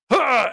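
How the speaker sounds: high, loud "huh" of exertion; effort grunt. Acted. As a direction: grunt as if snapping timber. Duncan shows off his strength.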